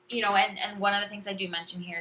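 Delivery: ordinary delivery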